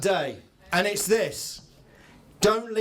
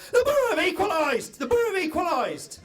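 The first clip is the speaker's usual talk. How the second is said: excited yell; 12 semitones higher than usual. Retold or imitated. A man leaning out of a car window yells the goal news to the crowd, jubilant. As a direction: shout the equaliser news in delight.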